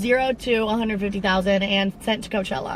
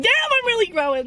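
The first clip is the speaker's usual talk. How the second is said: a sudden high squeal of pride; excited self-talk; 13 semitones higher, 3 dB louder than usual. Retold or imitated. Vlogger quoting her own thrilled inner voice, high excited squeal. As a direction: leap way up in pitch, thrilled at yourself. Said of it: "I'm like".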